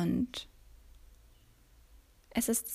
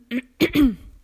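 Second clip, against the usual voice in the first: hoarse clearing of the throat; 5 semitones higher, 8 dB louder than usual. Real, not acted. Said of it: "entschuldigt meine Stimme". (kräftiges Räuspern)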